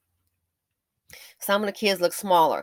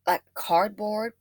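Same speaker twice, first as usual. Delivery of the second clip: ordinary talk, nothing marked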